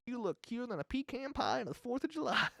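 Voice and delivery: high hammed-up folksy Southern charm; light mock Southern American accent; mock Southern drawl. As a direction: overdo a sugary Southern compliment, pitched high. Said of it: "something that she traveled through".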